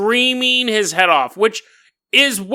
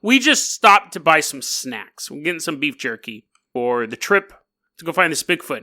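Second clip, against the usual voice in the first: ordinary talk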